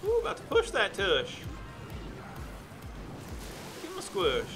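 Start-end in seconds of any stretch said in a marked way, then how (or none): none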